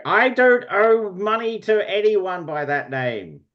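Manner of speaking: high-pitched shout from another room. Acted. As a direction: call out in high-voiced indignation, not full volume. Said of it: "you hear a shouting voice going".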